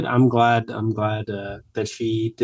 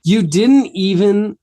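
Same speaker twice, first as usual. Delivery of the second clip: slow, high, loud mock reproach; playful exasperation; exasperated disbelief; 7 semitones higher, 5 dB louder, slower than usual. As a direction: scold a friend in drawn-out disbelief. Mock disbelief at coffee failure.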